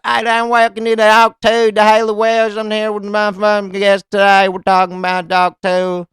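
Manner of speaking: words chewed up and dribbled out; chewed-up hayseed mumble. Retold or imitated. Podcaster mocking Hawk Tuah's show opening, garbled high hayseed voice. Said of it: "chew up words and let them dribble out".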